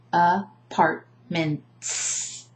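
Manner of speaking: apartments broken into a-part-ments with long final s; "Apartments" in three slow chunks, lingering on the s; syllable by syllable. Shown as a demonstration. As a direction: say "Apartments" very slowly, each syllable separate, hiss the ending. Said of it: "I highlight the, the syllables according to exactly where they begin".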